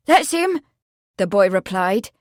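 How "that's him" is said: high, loud eager confirmation; excited. A narrator's reading. The boy replies to Edward, excitedly. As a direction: confirm it keenly, pitched high.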